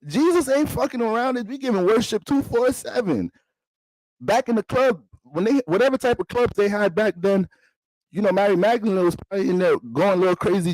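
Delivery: high excited preacher run, punch it; hyped-up preaching. Pretend. Comedian hyping up the congregation, high excited preaching. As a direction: preach it like a hype man.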